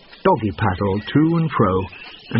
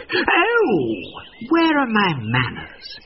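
mock polite; 10 semitones higher, much slower than usual. A narrator's reading. Fox faking politeness.

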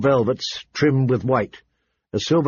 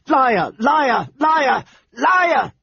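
very high, furious repeated shrieks; shrieking; 12 semitones higher, much slower than usual. A narrator's reading. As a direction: hurl each accusation higher, like an enraged girl. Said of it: "Arya shrieked".

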